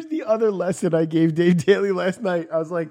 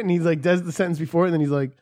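ordinary talk

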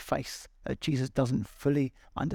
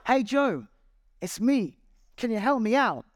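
calling out; 7 semitones higher, 4 dB louder than usual. Retold or imitated. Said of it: "Then a friend walks by".

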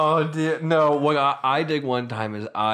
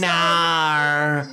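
drawn-out, raised Aussie vowel; Australian accent; 3 semitones higher than usual. Acted. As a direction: refuse in a broad mock Australian. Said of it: "I've noticed Australian people".

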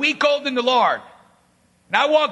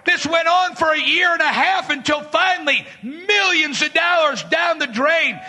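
ordinary talk

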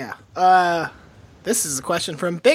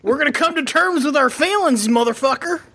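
higher-pitched, hard-charging Southern pep talk; shit-kicking grief counselor; 6 semitones higher than usual. Pretend. Grief counselor rallying mourners, shit-kicking Southern voice. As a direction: play grief therapy as a mock tough order. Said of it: "doesn't work if you're a grief counselor".

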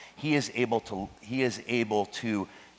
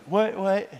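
higher, jolted awake, confused; startled; 8 semitones higher than usual. Retold or imitated. Boy woken by his teacher in class, startled higher what what. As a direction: snap out of a daydream. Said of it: "And I'd be like".

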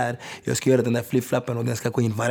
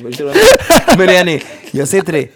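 words spoken through a high laugh; laughing out loud, much higher and louder; cracking up mid-sentence; 6 semitones higher, 11 dB louder than usual. Real, not acted.

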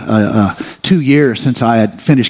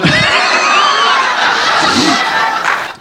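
burst of laughter. Real, not acted. Congregation laughing at the drawers story, big laugh. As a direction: laugh hard at the punchline.

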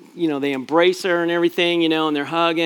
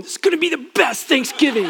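put-on higher, laughing teenage voice; teasing son; 9 semitones higher, faster than usual. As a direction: mimic the son's cheerful teasing. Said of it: "he's going".

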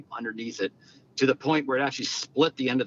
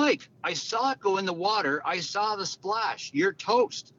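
higher, teasing shout-back; cocky call back; 8 semitones higher, slower than usual. Retold or imitated. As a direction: needle him from across the rough. Yelling back at Jordan.

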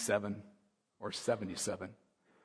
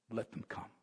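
hushed whisper; whispered Jesus; 3 semitones lower, 13 dB quieter than usual. Retold or imitated. Preacher voicing Jesus welcoming children, whispered. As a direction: breathe it tenderly. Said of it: "he went like this".

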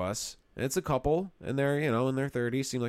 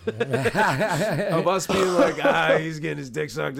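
louder, higher, words through real laughter; laughing; 5 semitones higher, 7 dB louder, slower than usual. Real, not acted. Joking about their degenerate stories, laughing. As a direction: crack up while telling it.

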